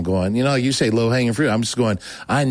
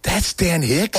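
high, stunned question; shocked; 7 semitones higher, much slower than usual. Retold or imitated. He learns the stranger was the champion, high shock. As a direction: let the realization jump up.

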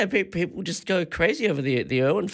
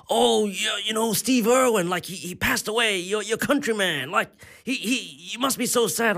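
lightly voiced gushing American stranger, pitched up; mock-earnest sympathy in a higher voice; 7 semitones higher than usual. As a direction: play the overly sympathetic tourist, voice raised. Mimicking American stranger about Irwin.

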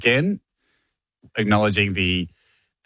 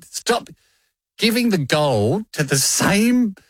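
retold slow, high-pitched outburst; exasperated driver; 9 semitones higher, slower than usual. Comedian retells an Uber driver's plea about awards, exasperated. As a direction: drag it out in mock exasperation. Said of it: "He goes".

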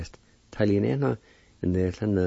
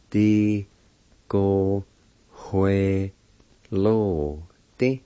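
"Di, go, hue, lo, di" with long gaps; syllable by syllable; much slower than usual. Shown as a demonstration. Teacher showing how pencil is said, syllable by syllable. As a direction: sound out each syllable slowly. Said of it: "It's pronounced like this".

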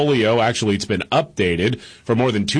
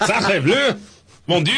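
high, mock French exclamations, laughing through them; hammed-up French accent; 8 semitones higher than usual. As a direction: play a bad stage Frenchman in a French accent. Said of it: "It's terrible".

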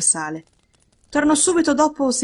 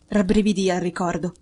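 usual speaking voice

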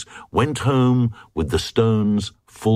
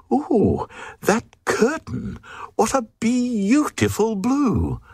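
very high, swooning lady's voice; gushing queen; 14 semitones higher than usual. Acted. Queen swooning over a curtain in a very high voice.

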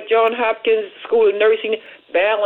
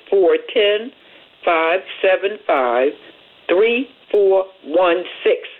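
slow repeat; slower than usual. Shown as a demonstration. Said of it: "Again, that number is".

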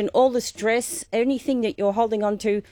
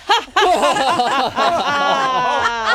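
loud long laugh; releasing laugh; 3 semitones higher, 4 dB louder than usual. Shown as a demonstration. Teacher shows the group how to laugh away tension. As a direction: burst out laughing, letting go. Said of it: "Let it go".

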